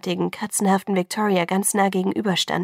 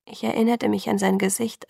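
ordinary delivery, nothing marked

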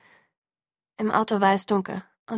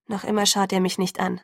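ordinary delivery

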